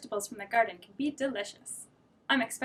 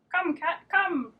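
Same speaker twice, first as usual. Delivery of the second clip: high, louder call; calling the cat; 7 semitones higher, 4 dB louder, much slower than usual. Acted. Dog calling the cat, loud.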